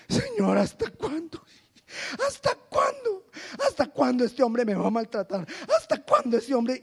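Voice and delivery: whining "¿hasta cuándo?" at the Lord; mock lament. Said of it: "Y sigo llorando por el bendito hombre". (queja lastimera imitada, algo más aguda)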